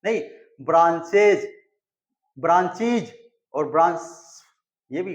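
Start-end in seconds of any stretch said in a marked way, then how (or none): 0.6-1.5 "ब्रांचेज" with a clear extra ez syllable; teacher models the right "ब्रांचेज"; correct plural demo. Shown as a demonstration. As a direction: say "ब्रांचेज" firmly, ending in ez. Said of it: "pronunciation जो है वो ब्रांचेज का ही हो पाया".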